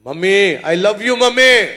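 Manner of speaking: put-on high, loud child's voice; happy little girl. A little girl hugging her mother, high and loud.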